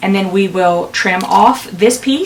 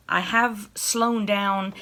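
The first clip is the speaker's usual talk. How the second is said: ordinary talk, nothing marked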